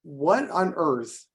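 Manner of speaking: normal talking voice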